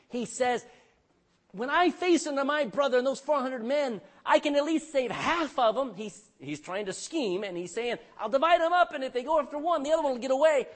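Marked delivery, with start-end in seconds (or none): 1.5-5.9 higher and louder, pressing; loud scheming plea. Acted. Preacher voicing Jacob planning before meeting his brother, loud. 8.2-10.7 high and loud, working it out; excited scheme. Acted. Preacher voicing Jacob's plan to split the camp, loud and high.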